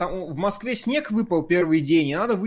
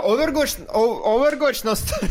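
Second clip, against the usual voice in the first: louder, higher hype call; hyped announcement; 7 semitones higher, 4 dB louder than usual. Acted. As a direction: sell it like an announcer. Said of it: "hyping the stream". (возбуждённо)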